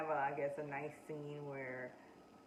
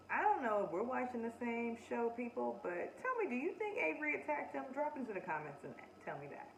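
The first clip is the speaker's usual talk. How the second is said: ordinary talk, nothing marked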